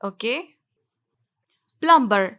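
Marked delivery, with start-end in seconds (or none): 1.8-2.3 प्लम्बर with the b sounded; wrong pronunciation. Shown as a demonstration. Teacher shows learners the mistake, mispronounced. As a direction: say प्लम्बर as PLUM-ber. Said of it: "बिल्कुल नहीं".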